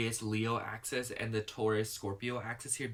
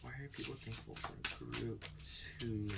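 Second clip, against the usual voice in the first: hushed voice, much softer than her chat; half-voiced, quiet asking; soft murmured question; 10 dB quieter than usual. Real, not acted. Repeating the question to the cards.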